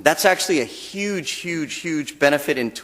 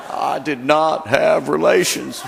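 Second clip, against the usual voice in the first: slow, drawn-out Clinton voice; lightly voiced Bill Clinton; Bill Clinton impression; slower than usual. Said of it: "It sounded like Clinton".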